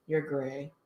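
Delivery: mild imitation of a dry chemistry teacher. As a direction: say it bored and matter-of-fact. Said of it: "this is how he spoke 'cause he was very dry".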